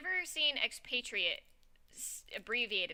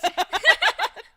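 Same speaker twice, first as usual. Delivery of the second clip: high shrieking laugh; 16 dB louder than usual. Real, not acted. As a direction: burst into a high squealing laugh. Shared burst of laughter.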